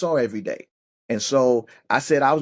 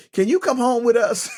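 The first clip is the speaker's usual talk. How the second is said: mom asking; 10 semitones higher than usual. Retold or imitated. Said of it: "the mom was like".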